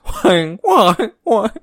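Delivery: high goose honk Huang huang huang. Pretend. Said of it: "Sound like a goose".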